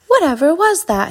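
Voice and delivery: mildly startled storybook exclamation; startled cry. A narrator's reading. Badger hearing a thud outside, mildly startled cry. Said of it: "he cried".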